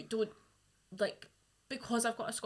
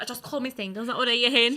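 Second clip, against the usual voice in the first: Glasgow granny; 11 dB louder, faster than usual. Retold or imitated. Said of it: "She's like".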